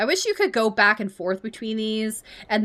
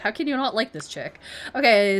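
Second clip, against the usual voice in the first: usual speaking voice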